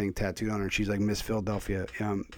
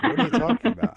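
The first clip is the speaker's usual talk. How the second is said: baffled listener; 13 semitones higher, 5 dB louder than usual. Retold or imitated. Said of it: "'Cause otherwise, it's like".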